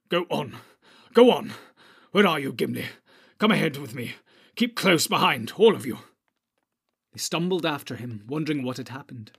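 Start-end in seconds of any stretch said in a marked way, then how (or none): 0.1-6.0 loud, short orders; urgent calling. A narrator's reading. Shaken wizard leads company through dark, urgent calling. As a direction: call them onward, pressing the pace.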